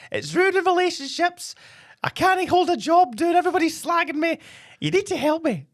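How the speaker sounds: very high squeaky voice, a man pleading. Acted. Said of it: "This voice of mine".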